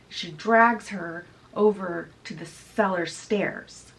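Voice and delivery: ordinary delivery, nothing marked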